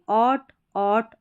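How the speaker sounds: very slow, stretched ऑट; sound drill. Shown as a demonstration. Teacher drills the ऑट sound for students. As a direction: repeat ऑट with a long open aw. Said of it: "सभी में ऑट sound".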